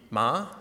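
ma rising like a question; ma, second tone, rising. Shown as a demonstration. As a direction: lift ma upward as if asking. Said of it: "The second tone is a rising tone".

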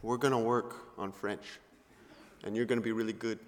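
low, soft, earnest promise; quiet reassurance. Retold or imitated. Host brother looks at Billy, quietly reassuring. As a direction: settle down and encourage him gently.

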